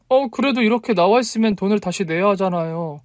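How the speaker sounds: high-voiced heroine protesting; flustered objection. A narrator's reading. 당황한 여자의 항의. (당황한 높은 목소리로 항의하듯)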